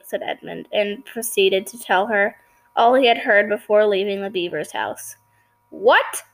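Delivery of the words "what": startled queen, voice lifted on the question; crying out. A narrator's reading. Queen to Edmund on hearing his news, crying out. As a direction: exclaim it as a sharp question. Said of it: "cried the queen".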